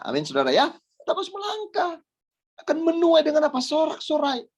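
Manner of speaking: high, ringing exhortation; impassioned preaching. Real, not acted. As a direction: preach it high, urging the congregation on. Exhorts the congregation. (khotbah berapi-api bernada tinggi)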